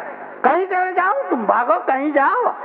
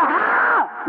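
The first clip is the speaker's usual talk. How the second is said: emphatic yes; 11 semitones higher, much slower than usual. Acted. Husband answers wife emphatically.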